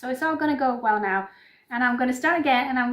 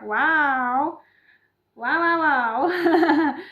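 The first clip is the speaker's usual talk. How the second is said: excited wow; 4 semitones higher, 7 dB louder, much slower than usual. Real, not acted. Marvelling at her own premiere.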